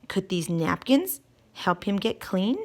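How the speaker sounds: usual speaking voice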